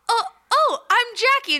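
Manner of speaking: high bubbly self-introduction; high sweet imitation. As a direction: pitch it way up, bubbly and welcoming. Imitating host introducing herself.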